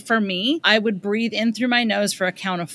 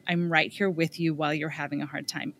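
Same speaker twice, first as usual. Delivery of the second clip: soothing parent; 4 semitones lower, 6 dB quieter than usual. Pretend. Soothing a struggling child.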